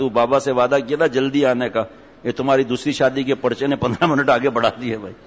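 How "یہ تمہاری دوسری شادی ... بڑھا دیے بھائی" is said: ordinary talk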